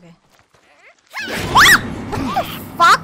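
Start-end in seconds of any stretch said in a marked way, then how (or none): 1.6-3.0 loud, high shocked yelp; startled shout. Real, not acted. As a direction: yell it out in sudden shock. Startled by a sudden game event. (ตะโกนตกใจเสียงสูง)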